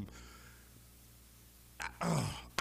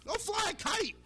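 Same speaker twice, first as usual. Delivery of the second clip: mock indignation; 14 semitones higher than usual. Mock outrage at Patriots PR.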